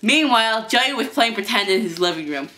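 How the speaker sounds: plain reading voice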